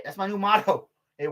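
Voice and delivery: usual speaking voice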